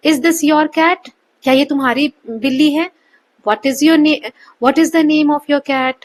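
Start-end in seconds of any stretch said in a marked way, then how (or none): 0.1-1.1 slow question rising at the end; rising yes-no tune. Shown as a demonstration. Teacher reads a yes-no example slowly, rising. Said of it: "इसका answer yes या no में होगा". 3.5-6.0 slow question sinking at the end; falling wh-question tune. Shown as a demonstration. Teacher reads a wh-question example, falling at the end. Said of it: "last में हम नीचे जा रहे हैं".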